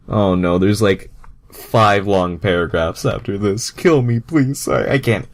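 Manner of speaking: high, loud mock anguish; despairing whine. Real, not acted. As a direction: beg for mercy as if it hurts. Despairs at more paragraphs.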